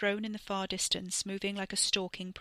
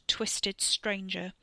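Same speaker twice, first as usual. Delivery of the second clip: plain reading voice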